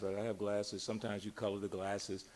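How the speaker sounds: usual speaking voice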